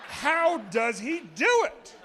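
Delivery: high, swooping, exasperated enthusiasm; worked-up excitement. Real, not acted. Comic urging an unimpressed crowd to share his excitement, gushing high. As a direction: gush in a high voice, stretching out the key words. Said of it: "Why are you not excited with me?".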